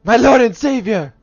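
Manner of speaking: high, loud cry of devotion; exclaiming. Acted. Player hails their lord and savior, loud exclamation. Hails beloved game character.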